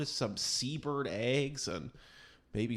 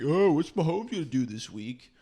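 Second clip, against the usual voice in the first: louder hyped broadcaster voice; mock sports pundit; 4 semitones higher, 8 dB louder than usual. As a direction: hype it like a TV pundit. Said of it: "it's like".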